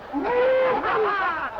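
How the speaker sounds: a loud, high burst of real laughter. Real, not acted. A contestant cracks up at his own mangled Hamlet quote, loud high laugh.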